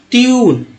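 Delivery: teacher answering with a long ट्यून. Shown as a demonstration. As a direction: stretch the ee-oo glide in ट्यून. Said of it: "ट्यून क्या बोलते हैं".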